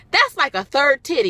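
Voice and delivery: ordinary talk, nothing marked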